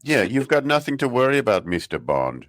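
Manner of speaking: mock German supervillain accent; German villain accent. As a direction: purr it like a German Bond villain. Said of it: "in his German accent".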